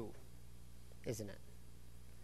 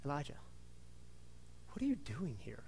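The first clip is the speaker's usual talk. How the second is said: hushed, low and gentle question; God's quiet question to Elijah, hushed; soft voice of God; 3 semitones lower, 9 dB quieter, faster than usual. Acted. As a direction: ask it softly, like a gentle whisper. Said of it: "what does the voice say to him".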